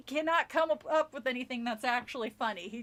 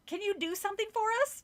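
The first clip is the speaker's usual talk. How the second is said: high voice; 9 semitones higher, faster than usual. A narrator's reading. Reading Mrs Miller's line.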